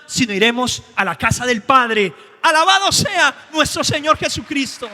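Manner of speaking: fiery pulpit preaching; preaching at full force. Acted. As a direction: build the praise up to a shout.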